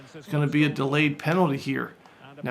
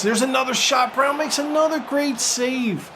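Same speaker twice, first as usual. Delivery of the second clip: excited shout; 8 semitones higher than usual. Real, not acted. Commentator hyping his keeper's second save, excited shout. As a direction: belt it out as the ball is stopped.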